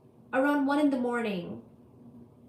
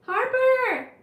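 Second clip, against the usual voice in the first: loud, high-pitched shout of a name; calling out; 12 semitones higher, 9 dB louder than usual. Real, not acted. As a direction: yell it across to get attention. Calling out to Harper mid-stream.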